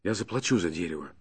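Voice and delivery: soft, hushed offer in his ear; whispered. A narrator's reading. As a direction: keep it low and secret. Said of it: "шепнул я на ухо Бирюку". (тихо, шёпотом на ухо)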